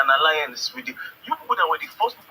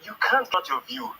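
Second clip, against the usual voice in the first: ordinary delivery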